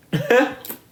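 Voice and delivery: short high burst of laughter. Real, not acted. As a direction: let a quick high laugh out. Laughing at a random memory.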